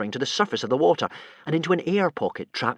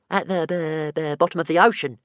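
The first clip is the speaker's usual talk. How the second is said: slightly higher stutter; lightly voiced stammering dragon; 5 semitones higher than usual. A narrator's reading. Toothless telling boys where they are, light stammer. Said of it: "said Toothless".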